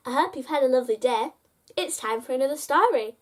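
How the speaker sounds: ordinary delivery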